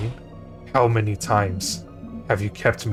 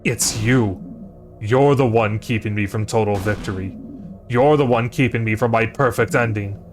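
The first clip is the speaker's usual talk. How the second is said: louder, building furious accusation; villain king snarls at Siffrin, furious accusation; rising fury; 5 dB louder than usual. Acted. King roars at the hero who foils him, growing fury.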